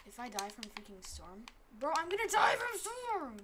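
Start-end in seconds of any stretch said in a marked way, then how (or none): 1.8-3.4 high, loud frantic yelling; panicking gamer. Real, not acted. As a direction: panic about dying. Dying in the storm.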